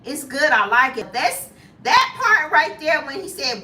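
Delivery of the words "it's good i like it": ordinary talk, nothing marked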